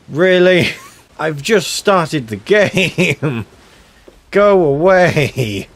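high, loud, laughing in alarm; laughing panic. Real, not acted. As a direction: laugh nervously while chased. Megalodon chasing him.

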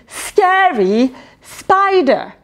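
ordinary delivery

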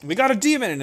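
loud, high bandit yelling a warning; alarmed bandit cry. Acted. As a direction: raise the alarm to the gang. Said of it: "they go".

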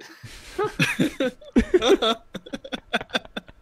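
long, high-pitched laughter; laughing fit. Real, not acted. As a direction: crack up helplessly. Cracking up at own lament.

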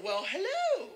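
high, sing-out hello; mock-sweet greeting. Comedian addresses odd front-row laughers, high teasing greeting.

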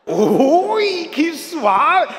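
high, loud, laughing mock pleasure; mock delight. Preacher voicing a shot woman in mock delight, high and loud. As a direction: squeal with silly delight. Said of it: "Es que estaba gritando".

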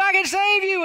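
mild high-pitched acted shout. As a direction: call up from the boat. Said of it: "shouted up to the man".

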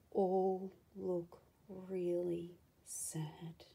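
slow, whispered and sad; hushed sorrow. Real, not acted. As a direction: let it fall to a whisper. Sad storyteller aside.

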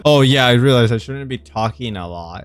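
ordinary delivery

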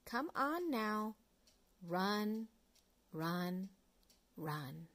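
ordinary delivery

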